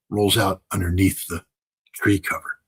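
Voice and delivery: ordinary talk, nothing marked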